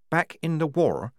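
plain reading voice

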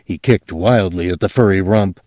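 ordinary delivery, nothing marked

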